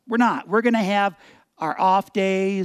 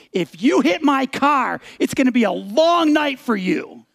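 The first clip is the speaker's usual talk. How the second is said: threatening; 5 semitones higher, 4 dB louder than usual. Retold or imitated. Driver leaning out to warn the kid, threatening. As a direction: stretch out a heated retold threat.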